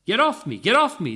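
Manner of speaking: high, loud shout. A narrator's reading. Young woman pinned under guards, shouting. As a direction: shout to get them off. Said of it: "the young woman shouted".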